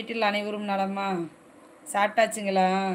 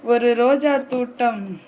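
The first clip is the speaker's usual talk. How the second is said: loud, drawn-out opening line; poem opening; 5 dB louder, slower than usual. Acted. Streamer opening a rose-garden poem for viewers, declaimed. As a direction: declaim the first line slowly and loudly.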